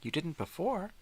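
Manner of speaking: squeaky baffled pet; dog's voice. Pretend. The dog questioning his teeth, high baffled voice. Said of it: "He don't get it".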